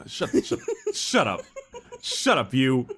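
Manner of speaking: telling someone off through giggles; laughing. Real, not acted. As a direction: laugh helplessly while shushing the co-host. Laughing at co-host's teasing riff.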